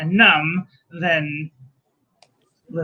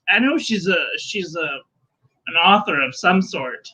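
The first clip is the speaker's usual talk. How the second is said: usual speaking voice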